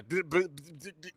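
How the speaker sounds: imitating a choppy broken internet line; choppy bad connection. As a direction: buzz like a cutting-out call. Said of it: "It's all choppy".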